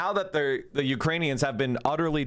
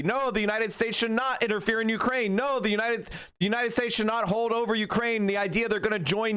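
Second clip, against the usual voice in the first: higher, urgent, insistent pleading; heated retold argument; 7 semitones higher than usual. Recalling how he warned everyone about Ukraine, heated and higher. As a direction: replay your own warning as if shouting into the void. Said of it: "I was there saying".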